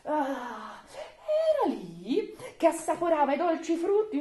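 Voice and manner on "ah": usual speaking voice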